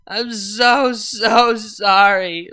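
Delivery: so stretched out very slowly, loud wail. Acted. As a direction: drag so out like a drunk sob. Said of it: "Vinyl wailed".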